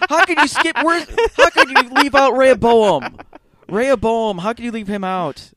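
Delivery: high, loud hammed-up protest; mock outrage. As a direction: rant like an offended fan. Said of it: "I'm like".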